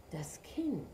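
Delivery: whispering Kind in shame; ashamed whisper. A narrator's reading. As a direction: breathe Kind ashamed, unvoiced. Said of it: "senkte der Ochse beschämt den Kopf".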